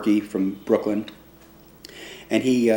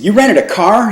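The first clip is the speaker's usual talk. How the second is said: high, louder incredulous question, rising on rented; amazed; 10 semitones higher, 8 dB louder than usual. Retold or imitated. Bandleader hearing the kid rented a car: astonished. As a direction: blurt it out in disbelief.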